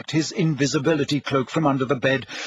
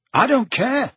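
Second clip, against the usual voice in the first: put-on young Harry voice; 9 semitones higher, 3 dB louder than usual. A narrator's reading.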